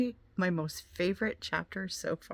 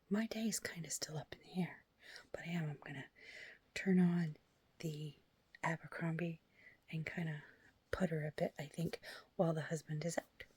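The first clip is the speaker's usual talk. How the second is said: whisper; 2 semitones lower, 9 dB quieter than usual. Real, not acted. Whispering her plans while the husband is out. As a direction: hush it all the way through. Quiet talk while alone at home.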